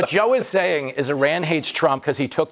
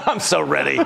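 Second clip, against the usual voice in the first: laughing mock echo of the guest; 4 semitones lower than usual. Host teasing the guest by echoing him, laughing. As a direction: repeat his line through a laugh.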